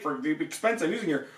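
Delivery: ordinary talk, nothing marked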